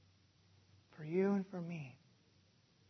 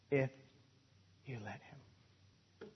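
breathy hush, slow and much softer; whispered; 6 semitones lower, 12 dB quieter, slower than usual. Shown as a demonstration. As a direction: drop to a whisper to drive it home. Hushed repeat of the line.

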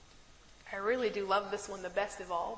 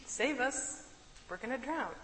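mocking the disciples; 4 semitones higher than usual. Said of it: "Are you catching it yet?".

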